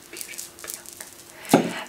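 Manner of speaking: soft, slow, breathed adoration; lovestruck whisper. Acted. Hortensio swooning over his love, lovestruck whisper. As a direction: whisper it dreamily to yourself. Lovesick suitor sighs her name.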